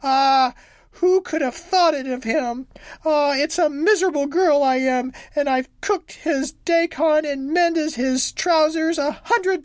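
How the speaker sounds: high, loud, slow lament; wronged young wife sobbing out her lament, high and wailing. A narrator's reading. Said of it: "cried and sobbed as if her heart would break".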